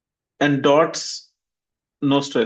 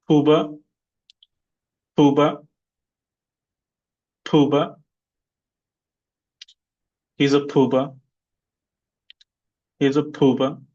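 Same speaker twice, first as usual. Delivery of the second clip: Pooba, weight on the first syllable; teacher drills word; stress drill. Shown as a demonstration. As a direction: model Pooba for repeating. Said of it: "repeat after me".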